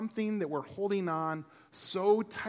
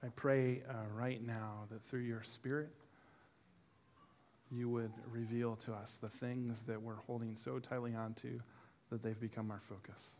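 soft, quiet closing prayer; 5 semitones lower, 11 dB quieter than usual. Acted. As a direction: pray softly over the congregation. Leads prayer.

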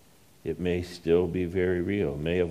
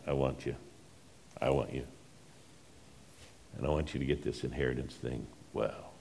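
soft, tender plea, much softer; as a loving Father, gently; 5 semitones lower, 11 dB quieter than usual. Pretend. God's voice, tender.

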